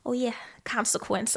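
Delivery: hushed, impressed whisper; mock whisper. Mock whispering to the listeners, impressed by new words. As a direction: breathe the words like a secret discovery. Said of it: "I'm like".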